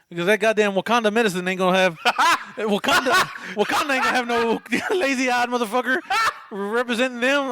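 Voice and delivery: talking through real laughter; cracking up mid-joke; laughing at his own Wakanda joke. Real, not acted. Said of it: "that's hilarious".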